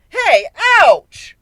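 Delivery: high, loud, whiny yelp; childish ouch. Acted. Pecked by birds.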